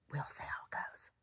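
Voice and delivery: whispered to herself. Real, not acted. Talking to the camera, a whispered aside. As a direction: breathe it out in a whisper.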